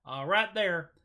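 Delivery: put-on same plug redone in a light southeast Texas accent; a hint of Texas country twang on the plug. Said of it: "I love talking in that accent".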